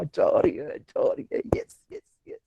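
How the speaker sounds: whispered glee, wife leaving; hushed secret celebration; gleeful whisper. Retold or imitated. (धीमी खुशी भरी फुसफुसाहट)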